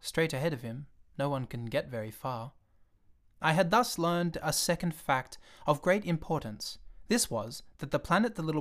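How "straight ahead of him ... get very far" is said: ordinary delivery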